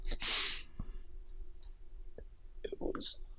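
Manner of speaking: tearful, hushed voice; after crying. Real, not acted. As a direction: voice catching, near tears. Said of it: "I'm a bit teary".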